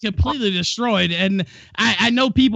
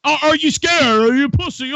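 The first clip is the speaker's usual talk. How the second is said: taunting; 4 semitones higher, 5 dB louder than usual. Real, not acted. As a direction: goad him into answering. Heated taunting in a debate.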